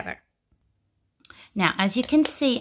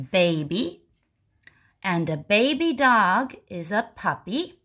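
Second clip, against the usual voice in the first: usual speaking voice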